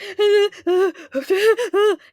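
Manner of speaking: high, loud mock sobs in bursts; imitated choked sobbing. Student made to read aloud, imitated choked sobs. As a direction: act out choking on words through crying. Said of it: "I barely choked out the words".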